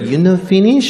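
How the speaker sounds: put-on high Japanese-accented question, rising; quick mock Japanese-accented query. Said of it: "people's bad English".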